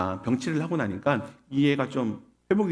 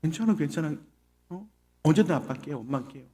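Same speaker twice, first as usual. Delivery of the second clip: softly spoken comfort; gentle acted reassurance; reassuring parent; 5 dB quieter than usual. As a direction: play a kind parent soothing a child. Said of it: "근데 대부분의 부모라면".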